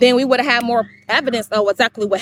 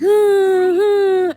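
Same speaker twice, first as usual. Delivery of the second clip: imitated crying; 9 semitones higher than usual. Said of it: "crying like".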